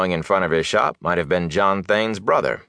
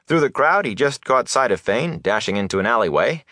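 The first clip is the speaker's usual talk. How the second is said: ordinary delivery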